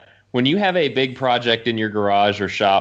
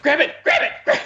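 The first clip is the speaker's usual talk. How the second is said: much higher, retold panic; panicked plea; faster than usual. Man begging his wife as the bed tips, high panicked plea. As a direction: squeak it out like the weight is slipping. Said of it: "I was like".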